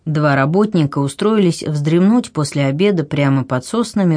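ordinary delivery, nothing marked

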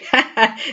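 short burst of laughter from a stern man; sudden laugh. Acted. Rivers bursts out laughing at Jane's shock. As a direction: let out one surprised laugh. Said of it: "scoppiò in una risata".

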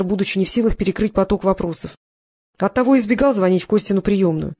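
ordinary delivery

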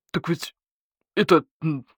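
halting, faltering wolf; taken aback. A narrator's reading. Wolf asked why he is celebrating, halting and taken aback. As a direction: grope for words, thrown off balance. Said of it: "опешил волк".

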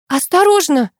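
high, loud caution; warning call. A narrator's reading. Heroine warns Alex about the floorboard. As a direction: warn him sharply. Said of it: "предупредила я".

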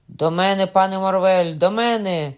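loud call for help; shouting for help. A narrator's reading. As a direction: call out to allies. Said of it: "гукнув Лагрюєр". (гучний заклик на допомогу)

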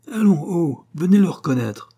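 high, loud urging; coachman's impatient call. A narrator's reading. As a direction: hustle him over. Coachman urging the hotel man.

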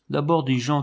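usual speaking voice

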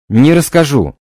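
loud desperate refusal, stranger voice. Acted. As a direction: repeat the refusal in another voice. Repeated refusal.

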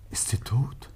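breathless, hushed question; fearful half-whisper. Acted. A husband asking the inspector if his wife is dead, in a hushed, fearful half-whisper. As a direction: barely dare to ask it.

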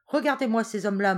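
loud, commanding shout; a general shouting his pride. A narrator's reading. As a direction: bark it at the consul. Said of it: "cria Garcia".

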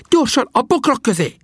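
very high, strained urgent call; shouted order. A narrator's reading. Sam sends companions into cover, high urgent shout. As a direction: cry out a hurried command at high pitch. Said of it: "kiáltotta Sam".

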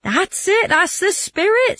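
high, drawn-out encouragement; egging on. Acted. Bruno urges his friend to keep shouting, egging on. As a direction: cheer him on in a high voice.

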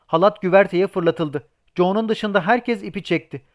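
usual speaking voice

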